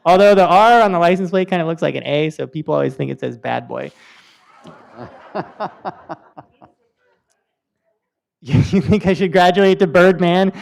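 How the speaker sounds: usual speaking voice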